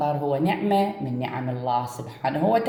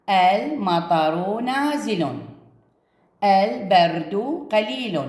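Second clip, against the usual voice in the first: المطر نازل and الولد يقول stretched very slowly, each word drawn out; very slow reading; much slower than usual. Shown as a demonstration. Teacher models sentence-start ال for pupils, very slow. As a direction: read it word by word, very slowly, for children. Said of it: "أيضًا هنا".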